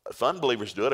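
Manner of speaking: normal talking voice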